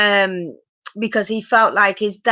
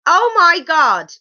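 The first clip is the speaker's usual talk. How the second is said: disbelief; 5 semitones higher, 5 dB louder, slower than usual. Real, not acted. Disbelief at the word.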